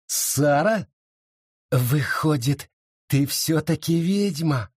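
a lightly voiced bitter sneer; mock her slowly, with a hollow edge; bitter mockery. Sara taunting Abita from the wagon, bitter mockery. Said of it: "с горькой усмешкой заговорила Сара".

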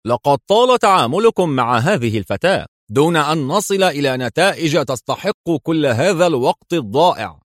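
a stern comrade's rebuke in borrowed voice; imitating Yakov sternly. A narrator's reading. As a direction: put on Yakov's hard-line voice and scold. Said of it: "مقلدا صوت ياكوف ولهجته".

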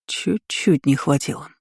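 slow, understated displeased mutter. A narrator's reading. Town mage eyeing the remaining crack, displeased mutter. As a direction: mutter it slowly, mildly dissatisfied. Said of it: "недовольно пробормотал штатный маг".

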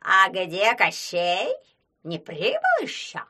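put-on loud, croaky old crone asking; old witch voice. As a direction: call out like a cranky witch. Lame witch asks everyone.

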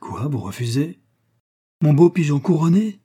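stunned, rising exclamation; distraught disbelief. A narrator's reading. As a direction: pitch climbing in astonished questions. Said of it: "d'un air égaré".

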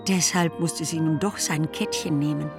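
usual speaking voice

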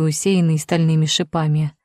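plain reading voice

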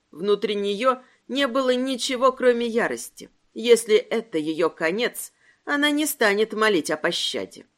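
plain reading voice

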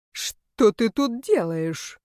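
slow, menacing words pressed out through the teeth; through gritted teeth. A narrator's reading. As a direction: grind each word out coldly. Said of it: "процедил он". (цедит сквозь зубы, медленно)

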